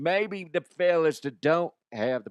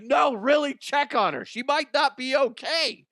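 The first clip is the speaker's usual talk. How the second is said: much higher, louder urgent plea; urgent insistence; 13 semitones higher, 4 dB louder than usual. Retold or imitated. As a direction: push her to act now. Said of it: "I was like".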